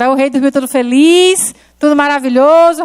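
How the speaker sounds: ordinary talk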